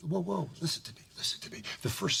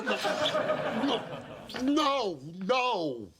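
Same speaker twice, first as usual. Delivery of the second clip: high protest; 9 semitones higher, much slower than usual. Acted. Refusing, high laughing protest.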